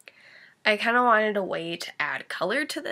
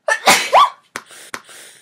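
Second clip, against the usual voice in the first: sudden loud sneeze; 14 dB louder than usual. Real, not acted. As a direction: let out a big sneeze. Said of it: "I'm gonna sneeze again".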